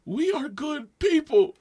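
high, slow mock-tearful insistence; verge of tears. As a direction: plead it as if about to cry. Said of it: "almost the verge of tears".

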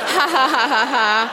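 a burst of real, loud laughter. Real, not acted. Reader laughs at her diary.

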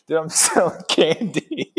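high, giggling friend's reply; laughing quote. Retold or imitated. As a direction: laugh mid-line while imitating him. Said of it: "He's like".

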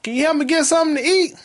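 high, lightly voiced old lady call; high mock old woman calling. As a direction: call out sweetly like a grandma. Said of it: "She said".

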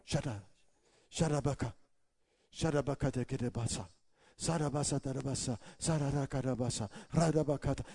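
soft, rapid made-up syllables; quiet tongues babble. Retold or imitated. Praying alone in a hotel room, softly in tongues. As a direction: murmur the babble under your breath. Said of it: "praying more".

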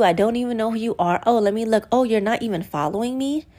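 ordinary talk, nothing marked

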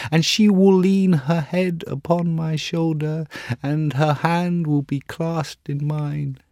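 put-on young man, yearning then breaking; pining for his love, slowing sadly; lovesick student.

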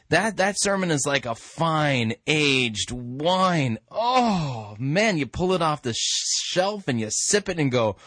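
ordinary talk

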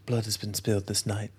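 quiet, grave film-hero impression; Orlando Bloom. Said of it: "That's the same voice".

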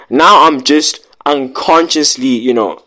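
ordinary talk, nothing marked

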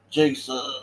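ordinary talk, nothing marked

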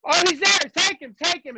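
high, hurried mock call; mock panicked defender. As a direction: yell the switch like a lost defender. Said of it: "doing this number like".

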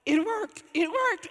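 high, loud burst of joy; excited. Retold or imitated. Healed woman rejoicing to herself, high and excited. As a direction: cry out it worked, thrilled. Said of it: "And she's excited".

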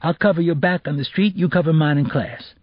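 put-on high-pitched, cocky teenage boy; lilting, pushy boy's street talk; street-talking schoolboy. Boy proposing a cheating deal to classmate, cocky.

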